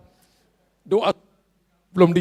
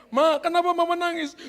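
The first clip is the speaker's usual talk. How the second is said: high, gentle mock question; husband asking; 9 semitones higher, 4 dB louder than usual. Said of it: "Lalu suami tanya". (suami bertanya dengan suara tinggi)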